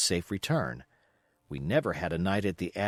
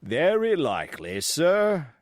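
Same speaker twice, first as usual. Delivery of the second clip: dry reply; 5 semitones higher, 4 dB louder, slower than usual. Acted. Captain answers the doctor dryly.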